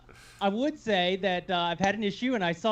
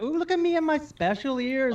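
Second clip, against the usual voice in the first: Jill impression; 8 semitones higher than usual. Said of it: "it's one of my great impressions".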